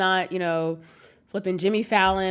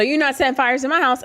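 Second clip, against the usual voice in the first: higher, louder scolding mother; stern mom; 6 semitones higher, 3 dB louder than usual. Retold or imitated. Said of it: "V O of my mom".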